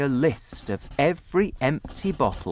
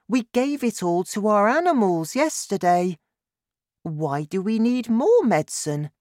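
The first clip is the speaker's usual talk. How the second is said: put-on high-pitched child's voice; puzzled boy questioning his dad; young son; 10 semitones higher, 4 dB louder than usual. A narrator's reading. Narrator voices young son George.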